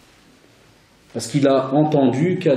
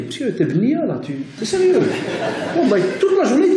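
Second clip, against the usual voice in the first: much higher, laughing mock pupil voice; incredulous pupil; 10 semitones higher than usual. Teacher imitating a pupil laughing in disbelief, high-pitched. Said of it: "Il y en a même qui reviennent à la récré".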